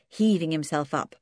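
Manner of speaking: usual speaking voice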